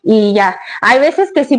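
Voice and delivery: ordinary talk, nothing marked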